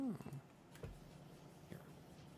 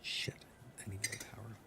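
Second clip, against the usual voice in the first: whispered to himself; 4 semitones lower, 17 dB quieter, faster than usual. Real, not acted. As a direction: breathe it out, barely voiced. Muttering to himself while playing.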